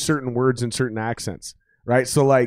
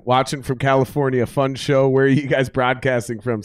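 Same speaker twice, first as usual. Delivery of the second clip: ordinary talk, nothing marked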